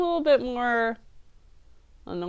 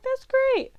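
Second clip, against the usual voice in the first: a much higher, chirpy mock girl voice; mocking Stella; 13 semitones higher than usual. Reviewer mocking Stella's bland movie reply, a high chirpy voice. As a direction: parody her empty cheerfulness.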